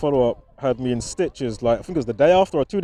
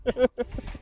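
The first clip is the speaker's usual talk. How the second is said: high soft giggle; 10 semitones higher, 6 dB quieter than usual. Real, not acted. Finds the story funny.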